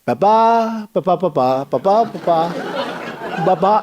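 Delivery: droning ba-ba babble, slowing down in the middle; sleepy monotone nonsense syllables; mock teleprompter drone. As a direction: parody a dull prompter reading. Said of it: "I'd go like this".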